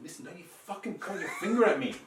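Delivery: heated but amused re-enactment; retold angry scolding. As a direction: lay down the law, acting out the row. Retold quarrel, scolding her.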